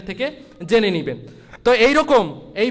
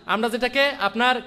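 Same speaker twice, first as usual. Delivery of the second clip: ordinary talk, nothing marked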